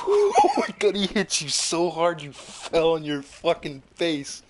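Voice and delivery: high, loud laughing disbelief; real laughter through the words; laughing hard. Real, not acted. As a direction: crack up while telling it. GM laughing at the hit.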